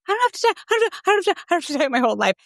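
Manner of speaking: very high, giddy, fluttering chant; flying little bird. Retold or imitated. Seventeen-year-old client sings out her freedom from dieting, high and giddy. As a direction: chirp it like a bird set free. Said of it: "like a little bird that's just, like, flying".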